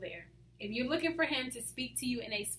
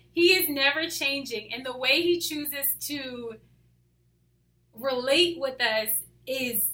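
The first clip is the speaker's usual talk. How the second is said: usual speaking voice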